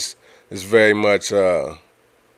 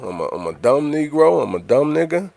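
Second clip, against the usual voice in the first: sarcastic challenge; 7 semitones higher than usual. Retold or imitated.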